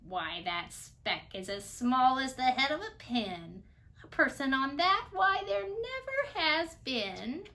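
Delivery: sour kangaroo. A narrator's reading. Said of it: "humphed a voice. 'Twas a sour kangaroo".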